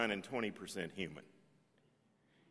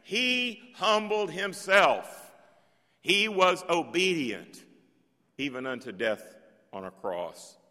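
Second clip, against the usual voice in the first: solemn reading; 4 semitones higher, 4 dB louder than usual. Acted. Reading the hymn aloud, slow.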